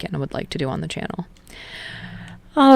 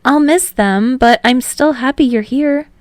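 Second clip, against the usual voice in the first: higher, louder, bashful and happy; bright higher voice, flustered but glad; higher, eager young man confessing happily; blushing Hinata; 6 semitones higher, 5 dB louder than usual. Acted. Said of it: "He's blushing when he says it".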